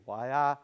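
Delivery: "waiachipi" with the weight on "wa"; stress on the beginning. Shown as a demonstration. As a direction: lean on the first syllable of "waiachipi". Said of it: "the emphasis on the beginning".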